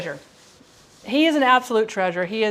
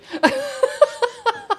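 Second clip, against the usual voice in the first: high burst of laughter. Real, not acted. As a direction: break into a delighted laugh. Laughing at own story.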